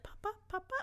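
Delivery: put-on high, faint old-woman voice; Coco. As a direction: call out softly and high, randomly. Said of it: "she's super old and just".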